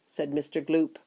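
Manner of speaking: ordinary delivery, nothing marked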